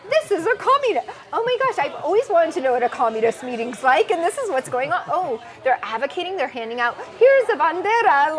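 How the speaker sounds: giddy, loud rush of excitement; retold delighted surprise, voice jumping up; excited discovery. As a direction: blurt it out, thrilled to stumble on it.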